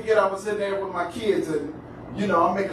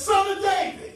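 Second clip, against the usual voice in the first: crying out; 10 semitones higher than usual. Acted. Woman calling out to Jesus for mercy, crying out. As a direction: call it out high, as a plea. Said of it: "she cries out to Jesus".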